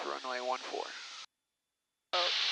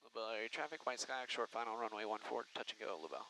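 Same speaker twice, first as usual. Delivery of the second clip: radio call; 3 semitones lower, 8 dB quieter than usual. Shown as a demonstration. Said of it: "instructor makes radio call".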